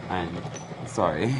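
normal talking voice

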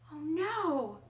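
ordinary delivery, nothing marked

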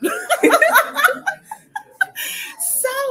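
a high burst of delighted laughter; loud laugh. Real, not acted. Host laughing as the guest walks in on the live stream. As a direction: throw back your head and laugh out loud.

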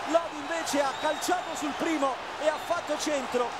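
goal commentary shout. Real, not acted. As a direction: belt out the goal.

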